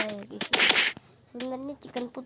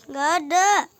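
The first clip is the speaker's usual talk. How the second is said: Nggak ada called out high and loud; shouting; 5 semitones higher, 12 dB louder than usual. Real, not acted. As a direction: yell it across the map. Calling out to another player. (berteriak keras)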